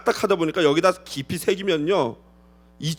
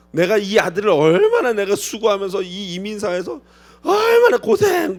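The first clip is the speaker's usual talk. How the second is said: aggrieved mother voice; 4 dB louder than usual. Pretend. Mother-in-law complains of raising son.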